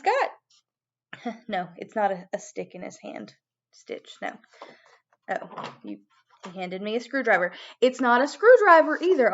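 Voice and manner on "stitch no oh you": whispered aside. Pretend. Scolding puppet under breath.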